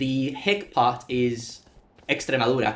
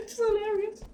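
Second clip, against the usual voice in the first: laughing; 7 dB quieter than usual. Real, not acted. Laughing at the quote.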